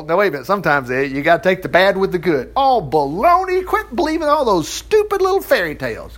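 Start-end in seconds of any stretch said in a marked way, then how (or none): 2.6-6.2 scoffing. Acted. Mocks a naysayer.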